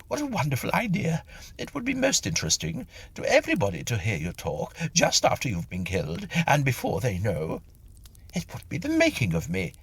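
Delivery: high, fast eager chatter; eager gushing. Acted. The medium to Mister Tilly, high eager gushing. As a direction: gush with relief and delight.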